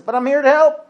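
high and loud, pushing to be believed; eager insistence. Acted. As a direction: insist brightly that you mean well. Demon insists to crying rabbi.

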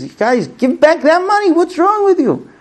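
very high-pitched indignant yell; storyteller's hammed-up angry yelling; acted yelling. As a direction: yell at the bandits as the enraged enforcer. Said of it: "he's yelling at them".

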